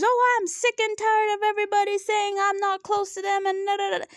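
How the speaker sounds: high-pitched mock rant, trailing into da-da-da; retold yelling. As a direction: imitate someone fed up and ranting at producers. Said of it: "she starts yelling at the producers".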